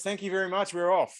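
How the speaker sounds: high-pitched, eager send-off; imitating pumped-up runner. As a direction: act out someone raring to leave. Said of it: "so he was pumped".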